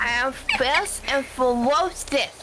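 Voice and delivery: chibi character voice. Acted. Showing off a chibi character to friends, cutesy chibi voice. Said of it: "when you do the Willy Tubby voice".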